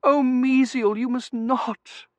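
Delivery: very high, drawn-out plea; high-pitched swooning distress; pleading wife. A narrator's reading. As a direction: implore husband melodramatically.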